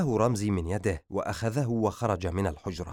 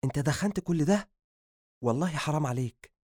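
higher shocked question; half-scolding amazement; mild surprise; 6 semitones higher than usual. Acted. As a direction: cousin shocked at the pile of cigarettes. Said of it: "فقال بدهشة".

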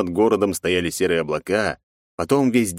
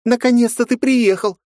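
put-on excited girl voice; 6 dB louder than usual. Жанна встречает Масаши.

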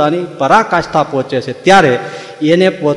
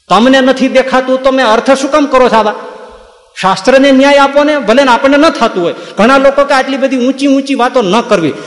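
indignant rebuke; 7 semitones higher than usual. Real, not acted. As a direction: scold them for twisting scripture. Preacher rebukes misreading.

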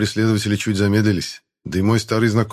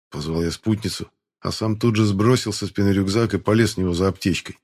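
ordinary delivery, nothing marked

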